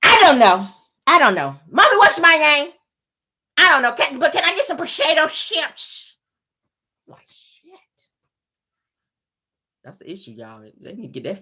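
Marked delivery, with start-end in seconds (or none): 0.0-6.2 put-on child voice with a thick lisping tongue; mock girl voice begging for chips; lisping little girl. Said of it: "That thick tongue". 7.1-7.7 whisper. Real, not acted. Aside after the mocking.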